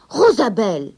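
loud sharp call of the name; scolding. Acted. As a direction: scold her from across the room. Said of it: "gronda le vieil homme". (appel grondeur et fort)

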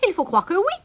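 high little giggle into the reply; titter, then answer high and teasing; amused kingfisher, high-pitched; sniggering. A narrator's reading.

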